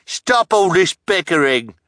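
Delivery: high, loud, drawn-out grumble; cranky. A narrator's reading. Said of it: "the pimpled man said crankily".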